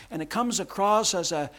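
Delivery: normal talking voice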